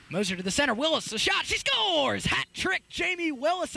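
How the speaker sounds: rising to a very high-pitched cry; real play-by-play elation, pitch soaring; excited goal call. Real, not acted. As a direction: erupt as the ball goes in. Live call of the hat-trick goal.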